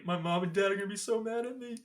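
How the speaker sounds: high-pitched mock wail, climbing to the end; mimicked Stu whine. Mimicking Stu's favorite line again.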